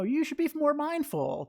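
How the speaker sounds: high-pitched imitation of well-meaning advice; mock advice-giver. Teacher imitating what people say about mindfulness, lightly mocking.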